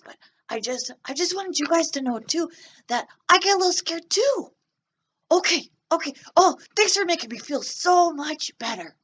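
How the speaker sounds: put-on high, bright little-boy puppet voice; puppet kid voice. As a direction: play a nervous little boy talking to the class. Teacher voices puppet Joe for kids.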